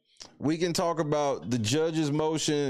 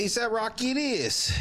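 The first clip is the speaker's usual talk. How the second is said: excited announcement; 8 semitones higher than usual. Real, not acted. Announcing vote winner.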